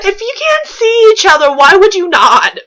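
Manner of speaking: high, loud, laughing protest. Real, not acted. Reader mocking the story's logic to her co-host, laughing. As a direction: question it through laughter.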